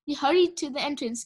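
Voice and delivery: ordinary delivery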